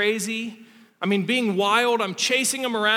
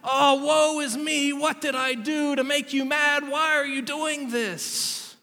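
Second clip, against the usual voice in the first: higher pitched woe-is-me complaint; mock self-pitying whine; 5 semitones higher, slower than usual. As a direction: ham up the self-pity toward God. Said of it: "start saying things like this".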